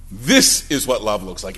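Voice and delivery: preacher repeating "This is what love looks like" in a low, deliberate voice; weighty repeat. Shown as a demonstration. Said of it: "You see, this is what love looks like".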